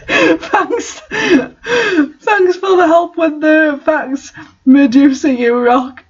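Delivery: high, slow thanks through laughter; laughing thanks. Real, not acted. Reader thanks the author's helper, laughing high and slow. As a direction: thank them while still giggling.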